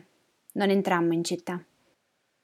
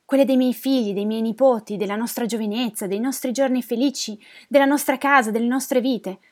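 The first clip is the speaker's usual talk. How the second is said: acted near-crying, pitched up; tearful plea; 4 semitones higher, 5 dB louder than usual. Old woman begging neighbours for her family photos, tearful. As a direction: plead emotionally, each phrase a fresh appeal. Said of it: "Ormai piangevo".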